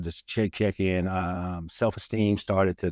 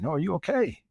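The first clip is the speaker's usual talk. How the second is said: higher-pitched worried check; imitating concerned wife; 10 semitones higher than usual. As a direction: play the wife checking on him. Voices his concerned wife.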